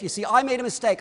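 ordinary talk

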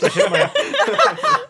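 high, slow pained squeak; pained squeal. Real, not acted. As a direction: squeak it out in pain. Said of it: "I think I bruised my hand".

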